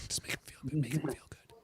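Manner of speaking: soft whispered aside, barely voiced. Real, not acted. Murmuring a private reminder to the hosts, whispered. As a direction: whisper it to yourself so nobody hears.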